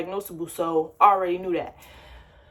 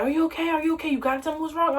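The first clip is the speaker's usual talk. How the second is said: higher, anxious mom voice; worried mother; 6 semitones higher, faster than usual. Retold or imitated. Mother calling her daughter in the Uber, high and anxious. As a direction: ask like a scared mother.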